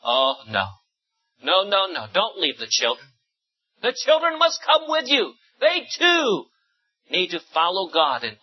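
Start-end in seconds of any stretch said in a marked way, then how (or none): none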